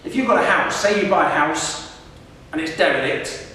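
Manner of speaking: normal talking voice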